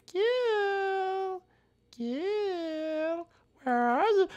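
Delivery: high, soft, drawn-out hunting call; playful falsetto call; sing-song calling. Pretend. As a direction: coo the name high to lure out a hider. Sing-song calling for hiding player.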